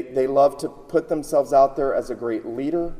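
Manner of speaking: ordinary delivery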